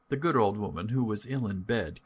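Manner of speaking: ordinary delivery, nothing marked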